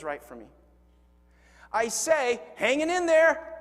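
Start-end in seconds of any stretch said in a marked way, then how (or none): 2.5-3.5 blurted with a wet sneeze; hanging in there with a sneeze. Retold or imitated. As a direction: try to sound cool and sneeze it out. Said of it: "everything that was in my nasal cavity".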